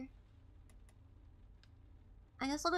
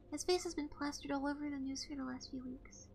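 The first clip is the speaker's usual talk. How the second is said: usual speaking voice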